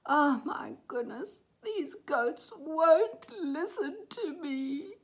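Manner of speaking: high, slow, drawn-out whine; exhausted little boy. A narrator's reading. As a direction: complain wearily after a long chase. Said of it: "He was panting".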